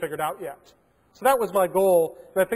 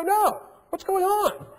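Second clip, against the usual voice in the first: higher, innocent mock surprise; feigned surprise; 13 semitones higher, much slower than usual. Conning the admin on the phone, higher mock-innocent surprise. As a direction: play dumb and let the voice jump up.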